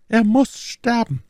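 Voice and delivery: high-pitched, insistent lady's voice; vengeful Milady. A narrator's reading. Milady repeating her death demand to Athos, high vengeful voice. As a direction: insist on it in a high acted female register. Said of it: "wiederholte My Lady".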